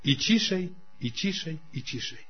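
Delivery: "i ciszej i ciszej i ciszej" fading to near silence. Shown as a demonstration. Preacher showing conscience's voice fading, dying away softly. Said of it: "ono będzie ciszej".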